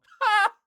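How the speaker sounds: shrieking burst of laughter; sharp laughing shriek; loud high laugh. Real, not acted.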